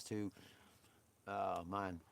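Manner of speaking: usual speaking voice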